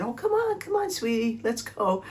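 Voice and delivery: high, sweet pet-talk coaxing; high baby-talk coaxing to a pet cat. Retold or imitated. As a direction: coax a reluctant kitty down the stairs, pitch way up.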